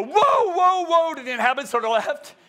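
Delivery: high, loud warning of judgment; loud proclamation of doom; angels' loud cry. Acted. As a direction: cry it out, starting high and easing down. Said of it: "so loud, they're probably shaking".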